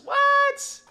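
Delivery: high, loud disbelieving yelp; a shocked high shout; outraged shout. Real, not acted. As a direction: blurt out in shock. Outraged at a rival's claim.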